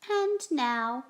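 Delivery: loud, high cry; cried out. Acted. Max cries out to the wild things, loud and high. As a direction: announce it like a little king. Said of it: "cried Max".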